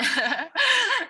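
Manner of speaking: high little giggle; laugh. Real, not acted. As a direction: giggle at your own joke. Host laughs before the joke.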